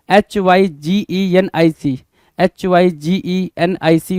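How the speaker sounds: each letter apart, slowly; slow spelling. Shown as a demonstration. Teacher spelling a word slowly for students. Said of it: "spelling out letter by letter".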